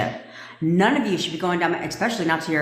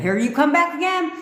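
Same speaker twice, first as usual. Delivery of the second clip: much higher and louder, hammed-up; mock exasperated exclaiming; 9 semitones higher, 4 dB louder than usual. Coach mocking women who restart the fight, exasperated high loud. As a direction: rant at the girlfriend starting round two. Mocking round two of argument.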